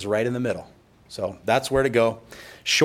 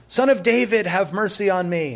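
calling out; 7 semitones higher than usual. Retold or imitated. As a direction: call out to Jesus from the roadside. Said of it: "that blind man calling out to Jesus".

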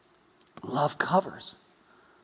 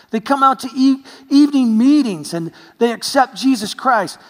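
louder, higher, building preacher; rising fervor; 7 semitones higher, 6 dB louder than usual. Real, not acted. Preacher building his point about young people, louder and higher. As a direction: lift it with growing fervor. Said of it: "preaching climax".

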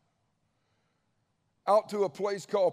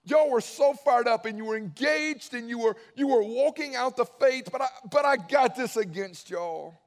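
much higher and louder, pleading; impassioned plea; 7 semitones higher, 6 dB louder than usual. Retold or imitated. God pleading with the church at Ephesus, loud and high. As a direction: cry it out, you were so fired up. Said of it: "He goes".

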